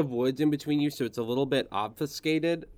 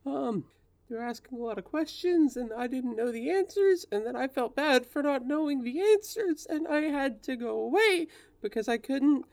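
upset high voice; 12 semitones higher than usual. Acted. Spirit upset, rambling.

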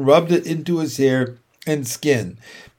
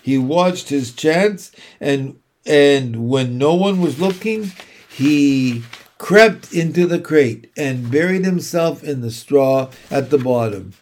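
ordinary delivery

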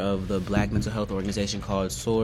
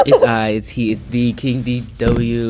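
grand royal entrance; 8 dB louder than usual. Pretend. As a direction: announce yourself like a king taking the stage. Grand self-introduction as co-host.

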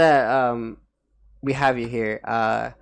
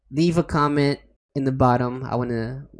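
normal talking voice

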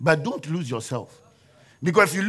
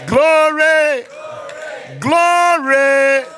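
high, loud congregation-rousing shout; rousing preaching; 8 semitones higher, 6 dB louder than usual. Real, not acted.

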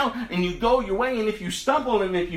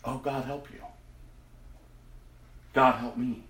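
hushed prayer; 7 semitones lower, 8 dB quieter than usual. Real, not acted. Sober prayerful aside.

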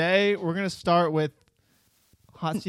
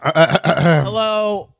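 podcast host intro; 14 dB louder than usual. Acted. Opening the podcast episode.

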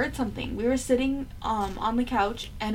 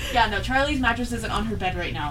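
ordinary talk, nothing marked

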